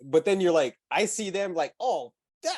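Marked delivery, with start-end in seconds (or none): 1.8-2.5 mock eager. Mocking Eddie's eager reaction.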